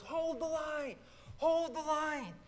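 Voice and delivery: high, loud battle cry; rally the retreating troops; rallying yell. Retold or imitated. Ben yells from the hilltop to rally the troops, loud and high. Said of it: "gets to the top and yells".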